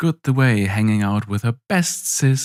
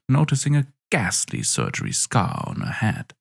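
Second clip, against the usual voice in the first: ordinary delivery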